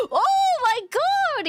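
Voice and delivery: loud high shout; retold yell. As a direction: yell it out in shock. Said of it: "He, he started yelling".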